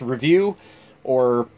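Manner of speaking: normal talking voice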